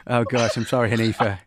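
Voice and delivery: giggling through the apology; can't stop laughing while speaking; real laughter at the accent game. Real, not acted. Host cracking up at the accents.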